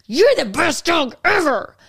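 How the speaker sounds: high, slow, harsh mock scolding; mad-sounding. Shown as a demonstration. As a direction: praise a dog as if furious at it. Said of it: "they think you're mad at them".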